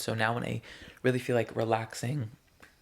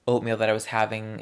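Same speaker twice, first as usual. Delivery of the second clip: ordinary delivery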